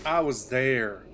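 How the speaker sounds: ordinary talk, nothing marked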